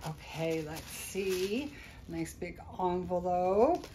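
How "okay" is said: usual speaking voice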